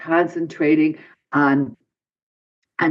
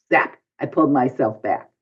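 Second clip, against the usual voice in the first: usual speaking voice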